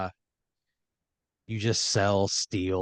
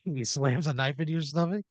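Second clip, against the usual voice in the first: usual speaking voice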